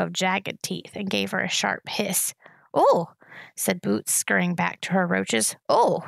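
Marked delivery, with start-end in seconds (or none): 2.7-3.1 put-on high little toddler voice, a sudden louder oh; a high, startled little cry; small child's oh. A narrator's reading. Toddler backing off from the hissing rat, high startled oh. 5.7-6.0 put-on high little toddler oh; short, high, startled oh; small child's oh. A narrator's reading. Toddler scurrying back to her roaches, high little oh.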